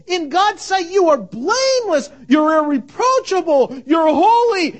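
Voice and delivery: high, loud, crying plea; pleading. Acted. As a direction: beg them to come in, voice straining. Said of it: "The author of Hebrews is pleading".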